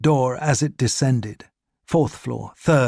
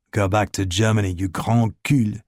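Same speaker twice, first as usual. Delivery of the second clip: Ricard's voice; 3 semitones lower than usual. A narrator's reading. Ricard to the Gestapo man, low contemptuous insult.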